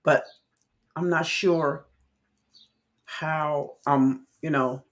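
ordinary delivery

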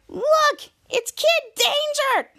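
very high, drawn-out fan cry; mock fangirl excitement. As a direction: imitate a thrilled fan spotting a hero. Fans spot the hero.

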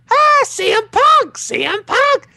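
put-on very high, squeaky kid voice, slow and loud; Mickey Mouse voice. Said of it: "that is his voice".

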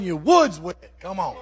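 ordinary talk

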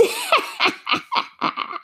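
very high, gloating witch cackle. A narrator's reading. The witch mocks Dorothy with a high cackle.

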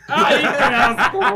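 high, loud, drawn-out squeal; stretched, shrieking disgust. Real, not acted. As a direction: cry out in laughing revulsion. Disgusted reaction to friend's choice.

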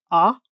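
ah said with the mouth wide open; open vowel ah. Shown as a demonstration. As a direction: hold an open "ah". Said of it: "it's open, like".

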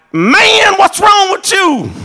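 loud, high, slowed shout at a student; yelling at kid. Retold or imitated. Preacher shouting down an interrupting student. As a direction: bellow it at the kid who interrupted.

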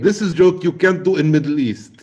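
put-on loud, high Middle Eastern accent; hammed-up Middle Eastern accent, loud; mock Middle Eastern accent.

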